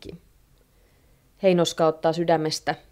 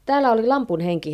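quick, raised voice of mild panic; flustered; 5 semitones higher, 4 dB louder, faster than usual. A narrator's reading. Wife explains the genie to her neighbour, flustered and quick. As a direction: blurt out the explanation. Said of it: "Heinoska selittää hätääntyneenä".

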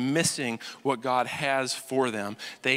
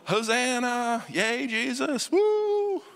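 rising, hammed-up cheer, much higher at the woo; cheering crowd; 10 semitones higher, slower than usual. Crowd cheering Jesus on the donkey, a mock ecstatic cheer. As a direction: cheer Jesus like a giddy fan.

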